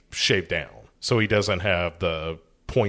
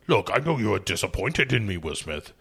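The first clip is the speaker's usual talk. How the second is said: lightly voiced orc, quick delivery; Nick the Orc. Orc owning up to his disappointed partner, lightly put-on creature voice. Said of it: "Nick the Orc is like".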